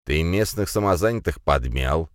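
plain reading voice

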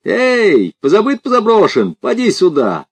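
high-pitched jeering hail; calling out. A narrator's reading. Village wit hails strangers from the logs, calling out high. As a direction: call him over at the top of your range. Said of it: "он крикнул".

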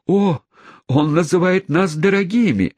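high-pitched indignant exclamation; hammed-up old schoolmistress outcry; outraged old governess. A narrator's reading. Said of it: "воскликнула самая старая и безобразная воспитательница".

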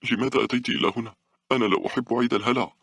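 put-on high, agitated boy voice; losing composure. A narrator's reading. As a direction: insist like an upset child losing control. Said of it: "كان الفتى يبدو وكأنه يفقد رباطة جأشه".

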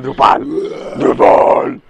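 loud mock demonic girl; creepy child. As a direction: bark the word like a possessed child. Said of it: "soy una niña poseída".

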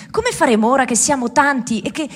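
ordinary delivery

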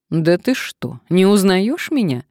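hearty, astonished question; incredulous surprise. A narrator's reading. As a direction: press an old friend to recognise you. Old friend surprised at not being recognised.

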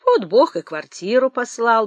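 plain reading voice

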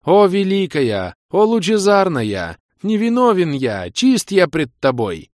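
high, slow, loud begging wail; loud wailing plea. A narrator's reading. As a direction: wail it out like a penitent. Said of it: "игрок громко заголосил".

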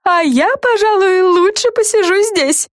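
high, slow, lazy declaration; fairy girl voice. A narrator's reading. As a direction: announce you're staying put. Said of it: "заявила Френ".

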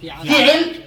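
فعل as fi'il, i in both syllables; two kasras. Shown as a demonstration. Teacher models remaining four patterns, two kasras. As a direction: say فعل with two i vowels, fi-'il. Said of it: "كسر الفاء مع تربيع العين".

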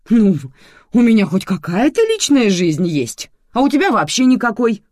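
loud, high-pitched comeback; angry retort. Acted. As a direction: throw it back in a heated row. Mother hits back in acted quarrel.

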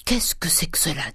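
whispered demand; breathy, intent questioning; hushed greed. A narrator's reading. Grandet seizing the gold case, hushed greed.

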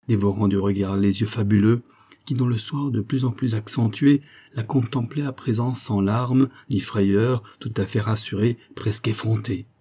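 usual speaking voice

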